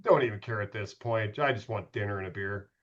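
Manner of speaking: low, soft, fed-up aside; retold weary shrug; resigned indifference. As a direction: drop it down like you've given up. Brushing off Boston, fed up.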